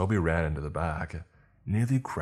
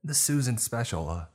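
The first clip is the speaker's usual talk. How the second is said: higher-pitched customer order; high, insistent; 7 semitones higher than usual. A narrator's reading. Customer talking over the manager, high and insistent.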